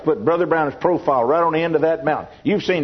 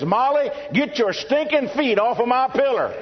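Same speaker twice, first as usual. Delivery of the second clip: high-pitched comic outburst of disgust; indignant complaint; 9 semitones higher than usual. Retold or imitated. Sleeping husband complaining to his wife, comic high-pitched indignation. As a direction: pitch it way up, hammed-up husband's protest.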